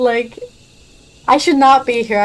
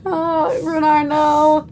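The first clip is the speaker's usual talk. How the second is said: cooing pity; 6 semitones higher than usual. Real, not acted. Streamer pities the little lion boy Runar, high slow coo.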